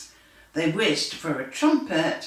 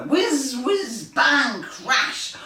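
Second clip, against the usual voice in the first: higher loud whizzing and crash; crash noises; 6 semitones higher, 4 dB louder, much slower than usual. A narrator's reading. Reading to children, super heroes flying into each other. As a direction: zoom and crash. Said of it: "flew around bumping into each other".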